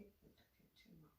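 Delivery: breathy whisper, barely there; whispered reply. Retold or imitated. Son answering mom as he runs upstairs, whispered. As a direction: toss it off in a whisper.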